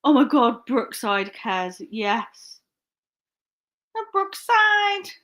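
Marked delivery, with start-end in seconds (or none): 3.9-5.1 high, drawn-out mock Scouse; exaggerated Scouse catchphrase, high and slow; bad Liverpudlian accent. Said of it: "like, Liverpudlian accent".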